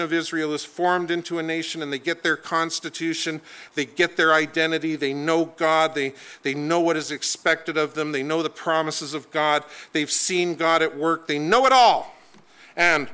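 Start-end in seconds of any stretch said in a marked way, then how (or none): none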